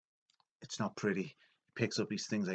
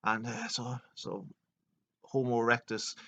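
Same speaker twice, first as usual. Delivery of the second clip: ordinary talk, nothing marked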